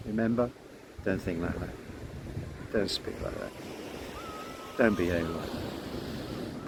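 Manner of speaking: a quiet inner voice giving gentle warnings. Acted. Inner intelligence counselling the self, hushed and low. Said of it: "intuitive intelligence ... saying".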